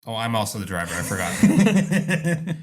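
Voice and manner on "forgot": laughing. Real, not acted. Laughing at own mix-up.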